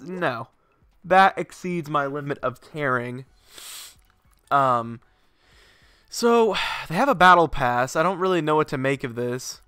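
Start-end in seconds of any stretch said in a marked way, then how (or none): none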